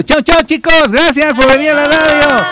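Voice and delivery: high, loud send-off; calling goodbye. Real, not acted. Radio host thanks visiting students, called-out goodbye. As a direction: call out farewell to a departing group.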